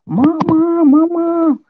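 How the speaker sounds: put-on high, drawn-out kid calling out for mom; imitated child's distant shout. Child calls to mother from far away, imitated shout. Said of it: "itu teriak, Pak, dari jauh".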